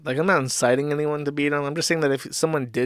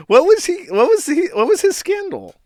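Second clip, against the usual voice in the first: excited recall; 5 dB louder than usual. Real, not acted. Straining to recall scandal.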